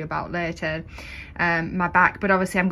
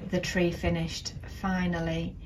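ordinary delivery

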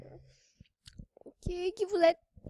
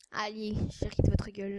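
ordinary delivery

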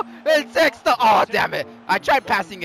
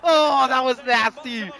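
usual speaking voice

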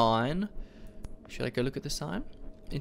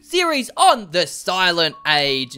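video intro announcer; 10 dB louder than usual. Acted. Host greeting viewers to a new series, announcer shout. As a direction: belt out a big channel greeting.

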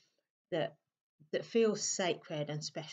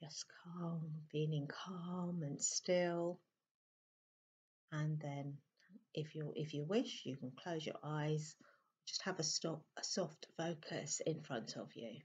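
guided meditation; 8 dB quieter than usual. Acted. Meditation teacher settling listeners into stillness, soft and calm. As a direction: settle them gently into calm and stillness, softly.

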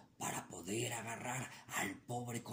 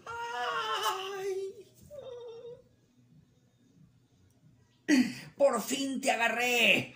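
loud, high cry of capture; triumphant shout; 6 dB louder, much slower than usual. Acted. Coyote grabbing the rabbit, triumphant shout. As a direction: yell in triumph as you pounce.

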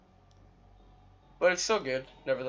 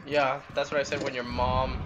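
normal talking voice

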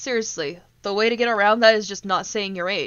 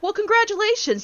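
much higher, mock-bright sarcasm; sarcastic congratulations; 11 semitones higher than usual. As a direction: congratulate him with biting sarcasm. Mocking him in the quarrel.

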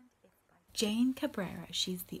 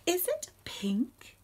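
high questioning; 8 semitones higher, 4 dB louder than usual. Acted. Reading questions to children.

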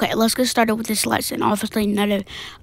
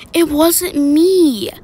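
loud, drawn-out protest, pitched up; protesting denial; 4 semitones higher, 7 dB louder, much slower than usual. Acted. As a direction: deny it loudly, stretching the words. Pupil denies the blame.

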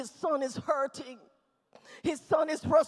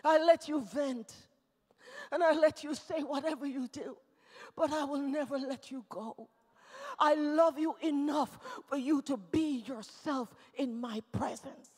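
soft, lowered voice after shouting; tender reassurance; 3 semitones lower, 6 dB quieter than usual. Acted. God letting a hurting child vent, softly reassuring. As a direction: hold the listener gently with the words.

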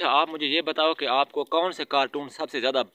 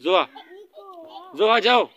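calling out; 8 semitones higher, 5 dB louder than usual. Real, not acted. Calling the child loudly.

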